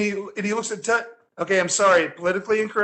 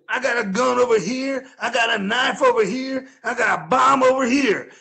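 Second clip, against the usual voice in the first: imitating the bum; 4 semitones higher than usual. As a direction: list hidden weapons with mock menace. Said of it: "this guy goes".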